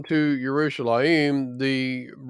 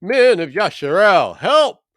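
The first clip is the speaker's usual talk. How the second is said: loud, high alarmed outcry for help; crying out; 6 semitones higher, 6 dB louder than usual. A narrator's reading. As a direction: shout it to the crowd, voice cracking high on help. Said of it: "crying out".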